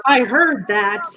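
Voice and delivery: mild singsong holler to someone upstairs. Acted. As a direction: call it up the stairs, stretching the words. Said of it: "Bailey hollers up".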